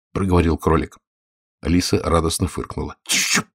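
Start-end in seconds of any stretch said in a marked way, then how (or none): 3.0-3.5 soft, whispery warning; frightened hush. A narrator's reading. Scared rabbit hushes laughing Alice, soft and frightened. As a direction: hush it softly, afraid of being heard. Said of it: "испугался кролик".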